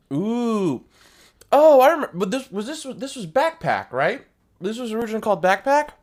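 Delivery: high, loud, delighted recognition; excited. Real, not acted. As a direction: burst out with excited realization. Suddenly remembers the set.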